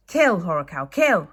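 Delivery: loud, urgent command to the dragon; urging shout. Acted. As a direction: egg the little dragon on, shouting. Fishlegs urging his dragon.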